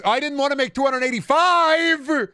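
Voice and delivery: high, loud whiny shout; squeaky outraged yell; mock outraged yell. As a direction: shriek it like a cartoon. Funny voice outburst.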